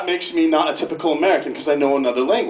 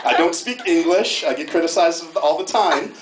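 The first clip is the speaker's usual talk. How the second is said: usual speaking voice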